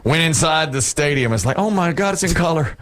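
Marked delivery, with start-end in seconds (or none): 1.6-2.8 high-pitched wide-eyed wonder; imitating an awestruck kid; mock amazement. Twelve-year-old seeing college basketball live, mock amazed. Acting his amazed younger self.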